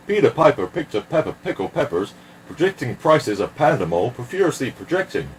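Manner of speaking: fast, swooping patter; rapid tongue twister. Acted. Performer racing through P words, rapid-fire tongue twister. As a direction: rattle it off at nearly double speed.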